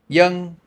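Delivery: put-on "young" said high and loud with a Y sound for V; Southern Vietnamese name demo. Shown as a demonstration. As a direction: model "young" for students to copy. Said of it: "One more time".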